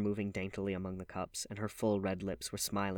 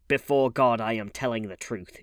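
loud solemn vow; crying out; 2 semitones higher, 8 dB louder than usual. A narrator's reading. Woman swearing to James Vane that she speaks truth, loud. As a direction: swear it to heaven. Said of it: "she cried".

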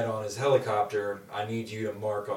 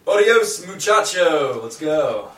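high, loud and bright; loud cheery Carlos; 7 semitones higher, 11 dB louder, slower than usual. Acted. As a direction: call it out gleefully, pitched way up. Carlos voiced by GM.

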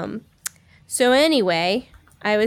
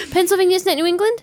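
high, fast, disbelieving; surprised; 10 semitones higher, 3 dB louder, faster than usual. Real, not acted. Surprised question.

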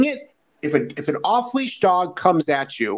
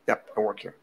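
lower, softer eager clerk; store clerk voice; 6 semitones lower, 8 dB quieter than usual. Acted. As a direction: play the clerk, softly insisting. Acted clerk replies.

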